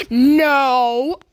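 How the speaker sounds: sudden loud, drawn-out shout; loud protest. Real, not acted. Gamer seeing a friend join, loud protest. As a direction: shout it out in dismay. Alarm at friend joining the game.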